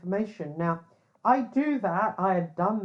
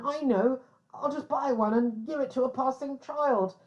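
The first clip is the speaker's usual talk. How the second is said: mock reporter; 5 semitones higher than usual. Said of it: "mocking a reporter's plan".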